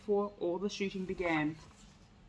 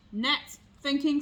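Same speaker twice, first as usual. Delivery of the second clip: slow, higher, mocking disbelief; snickering; 5 semitones higher, 4 dB louder, slower than usual. A narrator's reading. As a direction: snicker at the idea. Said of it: "snickered the fox".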